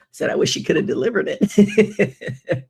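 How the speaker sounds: words through a high, loud laugh; laughing at her own joke. Real, not acted. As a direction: laugh while getting the line out.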